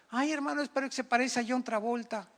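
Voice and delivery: high, put-on pleading voice; mock starry-eyed single. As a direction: imitate a lovestruck young believer protesting. Mimics a dreamy single's objection.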